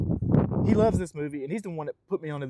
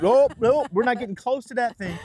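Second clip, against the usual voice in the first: loud, raised mock refusal; alarmed refusal; 5 semitones higher, 5 dB louder than usual. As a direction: back away with a mock-alarmed, emphatic no. Retold alarmed refusal at pumpkin.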